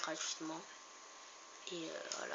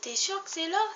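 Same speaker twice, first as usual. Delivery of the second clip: high incredulous; 11 semitones higher, 8 dB louder than usual. Real, not acted. Doubting the exit.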